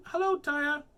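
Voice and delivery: high, loud hello; friendly greeting. A narrator's reading. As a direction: call out a bright high greeting. Friends greet her in story.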